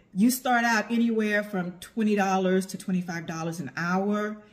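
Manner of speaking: normal talking voice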